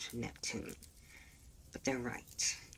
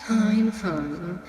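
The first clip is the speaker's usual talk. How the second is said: much louder, higher brush-off; loud mock surrender; 4 semitones higher, 10 dB louder than usual. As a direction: throw it back loudly, hands up. Phobos backs off, mocking.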